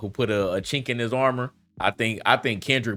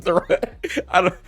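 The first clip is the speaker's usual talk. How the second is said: higher, louder, laughing mock Toronto accent; 9 semitones higher, 6 dB louder than usual. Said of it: "the accent that goes on there".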